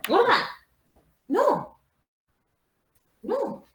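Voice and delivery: very high-pitched calling out; scolding the dog. Real, not acted. Teacher to her dog mid-class, scolding. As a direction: cry out sharply to stop the dog.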